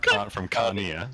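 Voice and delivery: laughing while getting the name right; laughing correction. Real, not acted. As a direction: stumble over the name, giggling. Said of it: "The Russian Oblast of Crimea".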